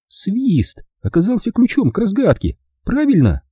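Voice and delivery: put-on high girl's voice, asking eagerly; quick question. A narrator's reading. Hilda eagerly questions Rick about the whistle. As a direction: jump in fast as the girl. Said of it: "быстро спросила она".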